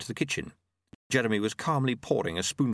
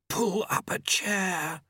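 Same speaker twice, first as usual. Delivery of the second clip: high, drawn-out hiss of a line; 9 semitones higher, much slower than usual. A narrator's reading. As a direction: draw it out slowly in a high voice. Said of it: "Famine hissed".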